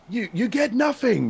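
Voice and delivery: high-pitched slow mock scream; drawn-out imitation of a screaming outburst; Willy Wonka. Guest quotes a tiny hidden clip from his video, mock scream. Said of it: "Willy Wonka screaming".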